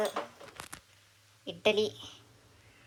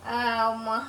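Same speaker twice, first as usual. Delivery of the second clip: normal talking voice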